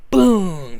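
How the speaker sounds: loud, punchy "boom"; imitated impact. Narrator acts out a slam into the wall, loud boom. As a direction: hit it hard like a sound effect.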